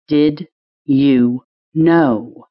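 each word separated by a pause; slow demanding, word by word. Acted. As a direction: demand it slowly, one word at a time. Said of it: "pausing between each word to emphasize my question".

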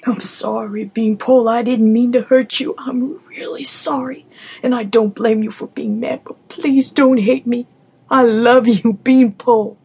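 loud, earnest heartfelt plea; a father begging forgiveness; sincere apology. Acted. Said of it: "tighter and more sincerely than ever".

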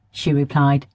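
ordinary delivery

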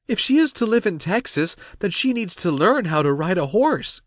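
very high-pitched story character; high, insistent voice for Cassie; Cassie's eager character voice. Acted. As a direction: pitch it well up, putting forward a plan.

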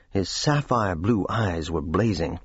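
usual speaking voice

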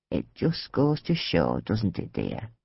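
put-on old lady teasing Louise quietly; sly hushed aside. A narrator's reading. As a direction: drop it low like a secret.

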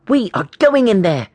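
high, emphatic schoolboy resolve; determined boy, no room for argument; stern, determined. A narrator's reading. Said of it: "said James sternly".

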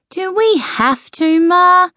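high, drawn-out whine to mother; whiny protest from a reluctant son; reluctant complaint.

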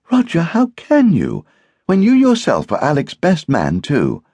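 high, loud reproach; reproachful. A narrator's reading. Hostess rebukes the best man, reproachfully. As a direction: scold the guest, pitched high.

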